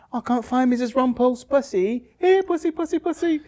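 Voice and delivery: put-on quavering old lady calling her cat. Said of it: "it was like".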